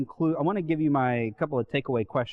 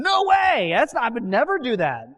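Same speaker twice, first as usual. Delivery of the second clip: high, loud indignant protest; mock outraged no way, I'd never; hammed-up shouted denial of betrayal; emphatic denial; 9 semitones higher, 6 dB louder than usual. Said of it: "to be an emphatic denial".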